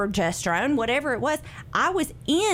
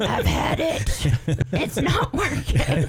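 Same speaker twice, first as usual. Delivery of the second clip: laughing; slower than usual. Real, not acted. Guest laughing to hosts about caffeine not working. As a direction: laugh the words out slowly. Laughing retelling her exhaustion.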